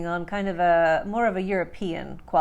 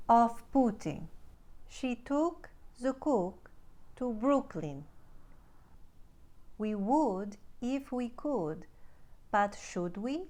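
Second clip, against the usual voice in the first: slow, soft Ukrainian-accented practice lines; 4 semitones higher, 7 dB quieter than usual. Shown as a demonstration. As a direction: Ukrainian accent, softly, every short U as tight OO. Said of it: "one simple uh to oo".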